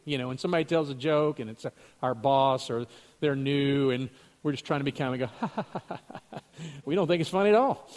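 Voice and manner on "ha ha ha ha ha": a soft, hollow polite ha-ha; courtesy laugh. Shown as a demonstration. Laughing politely at the boss's joke, soft fake ha-ha. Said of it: "the courtesy laugh that sometimes we do".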